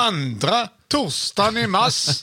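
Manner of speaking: high, slow auction call in Småland dialect; hammed-up Småland calling voice; auctioneer-like Småland accent. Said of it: "Det låter som en auktions".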